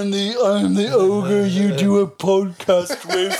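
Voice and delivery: loud, slow, high hunchback voice; slurred mock monster, near laughing; Quasimodo. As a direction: play a hammed-up lumbering ogre. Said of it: "I love doing Quasimodo voice".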